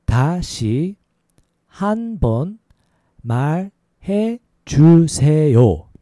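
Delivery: syllable by syllable, with gaps; slow demonstration. Shown as a demonstration. Teacher showing learners how to ask for a repeat, slow. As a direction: draw out each syllable for learners. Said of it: "A little faster".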